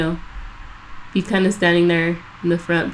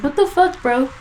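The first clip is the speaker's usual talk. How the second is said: pitched way up, incredulous; mock disbelief; 6 semitones higher than usual. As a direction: squeak out the disbelief. Retold outburst at coworker.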